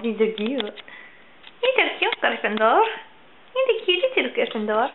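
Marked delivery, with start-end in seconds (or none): none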